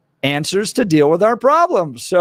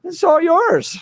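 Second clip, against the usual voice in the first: mocking party leaders; 10 semitones higher than usual. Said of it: "were like".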